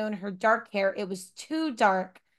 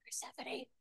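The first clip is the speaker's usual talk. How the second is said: whisper; 20 dB quieter than usual. Real, not acted. Scolding cat chewing cord.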